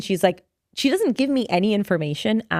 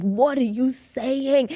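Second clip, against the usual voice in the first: high, drawn-out mock horror; horrified disbelief; 5 semitones higher, much slower than usual. Child reacting to friend's gossip, high drawn-out disbelief. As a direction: stretch it slowly and pitch it up.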